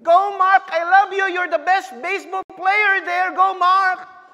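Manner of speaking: loud, high proud cheer; cheering shout. Retold or imitated. Mother cheering her son at baseball, loud high proud shouting. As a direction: scream support across the field.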